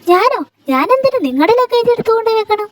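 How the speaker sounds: high, affronted voice; how dare you ask me; young woman protesting, pitched high and outraged; indignant disbelief. Acted. Anamika indignant at the order.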